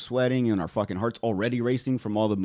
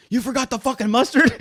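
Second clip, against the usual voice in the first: angry mom; 11 semitones higher, 3 dB louder than usual. Retold or imitated. Mom voiced in story.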